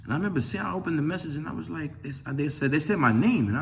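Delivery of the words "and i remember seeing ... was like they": ordinary talk, nothing marked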